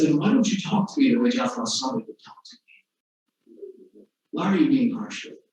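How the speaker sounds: whispered, pointed question; soft reproach. Retold or imitated. The atheist quietly rebuking Mufaddal for his harshness, whispered. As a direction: hush it, calmly shaming him.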